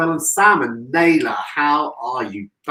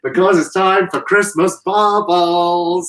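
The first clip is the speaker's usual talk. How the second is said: announcer voice; 5 semitones higher, 4 dB louder, much slower than usual. Pretend. Announcing bubbles theatrically.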